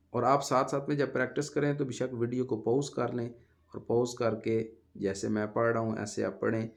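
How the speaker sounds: ordinary delivery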